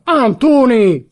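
high, loud, drawn-out correction; insistent shout. A narrator's reading. Visitor contradicting the father's name, shouting insistently.

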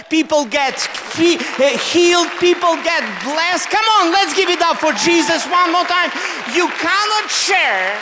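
loud, high revival fervour; rally the congregation into applause at full force; rousing preaching. Real, not acted.

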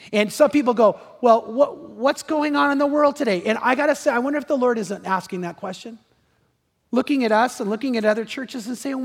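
high, loud pulpit voice; impassioned preaching. Acted. Pastor challenging the church about the world today, impassioned.

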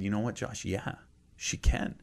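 father gently admits mom could die; gentle honest answer. Retold or imitated. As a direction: tell him the hard truth tenderly. Father gently telling son truth.

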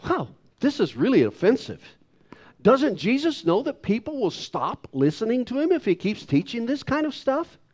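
high, incredulous mock complaint; put-on indignant disbelief; offended disciples. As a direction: imitate affronted grumblers, pitch swooping up high. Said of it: "They were saying".

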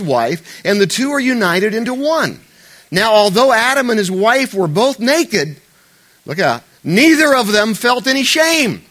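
loud, slow preached reading; emphatic scripture reading. Acted. Preacher reading Genesis to the congregation, loud and slow.

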